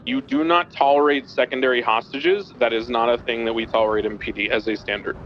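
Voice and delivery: normal talking voice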